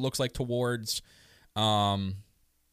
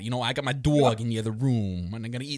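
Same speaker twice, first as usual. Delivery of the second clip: put-on teasing Queens accent through laughter; mock Queens accent. Podcaster imitating co-host's denial, mock Queens accent. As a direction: exaggerate the Queens vowels to rib a friend. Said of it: "that's that Queens accent".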